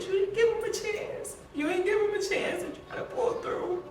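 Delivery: high-pitched, anguished yell; crying out at her that he never got a chance; retold screaming. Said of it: "I just kept screaming".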